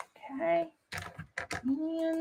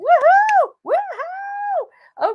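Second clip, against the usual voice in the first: cheering; 5 dB louder, much slower than usual. Real, not acted. Celebrating finished cards.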